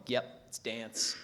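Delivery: stoned drawl. Acted. Stoned reply.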